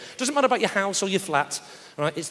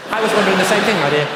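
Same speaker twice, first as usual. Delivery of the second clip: mock posh husband. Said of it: "hear the conversations".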